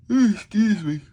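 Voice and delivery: high, loud yawn through the words; real yawn. Real, not acted. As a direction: talk right through a big yawn. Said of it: "There's the yawn".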